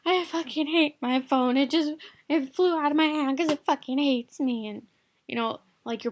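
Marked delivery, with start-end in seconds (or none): 0.0-4.7 mock meltdown over a trifle, higher and louder; overblown outrage. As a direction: blow a small mishap into a dramatic rant. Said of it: "turn into huge things like".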